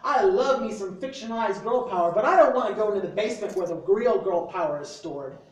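loud, punchy slam poetry delivery; spoken word poem. Acted. Reading his poem to friends in a bookstore, loud slam declamation. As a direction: declaim it like a slam poet calling out the crowd.

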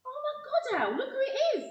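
excited surprise. Acted. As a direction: call out, delighted to spot him. Recognising old friend in crowd.

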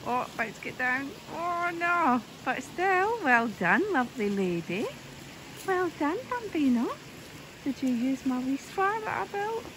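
high, loud praise for a hen; excited cheering. Real, not acted. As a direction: cheer her on, delighted. Cheering chicken off spar.

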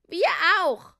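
high, very loud call; eager group shout. A narrator's reading. Four helpers joining in, loud high shout. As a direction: shout it like a whole gang. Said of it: "riefen Bill, Bob".

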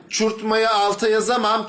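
ordinary talk

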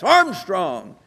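high, loud imitation of an angry yell; retold yell. Farmer yelling at two college visitors, retold angry yell. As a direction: yell the name like an outraged farmer. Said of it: "he just yelled at us".

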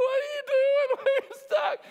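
panicked Israelites. Retold or imitated. Israelites panic at Red Sea.

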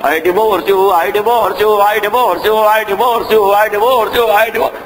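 "I divorce you" run together without a break; mock repeating it a thousand times; endless repetition. Shown as a demonstration. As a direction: chain the repeats in one even, droning stream. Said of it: "same word one thousand times at once".

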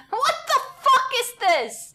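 high, loud laughing outburst; laughing shock. Real, not acted. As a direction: burst out laughing in disbelief. Startled by a photo, laughing.

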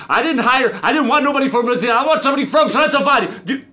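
high, hurried vampire tirade; mock-angry outburst, rattled off fast; indignant rant. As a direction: rail indignantly in a comic accent. Angry rant about hiring wrong assistant.

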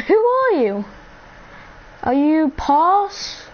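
loud, high, playful who-are-you; teasing loud interrogation of a viewer; excited questioning. Real, not acted. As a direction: pester the viewer happily. Excited quizzing a new viewer.

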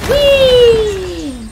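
high, loud drawn-out whee; gleeful whee. Real, not acted. Gamer riding along in the car, gleeful whee. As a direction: squeal it like a joyride.

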